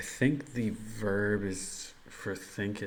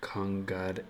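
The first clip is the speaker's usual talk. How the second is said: slow tentative Japanese; much slower than usual. Acted. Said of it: "would it just be".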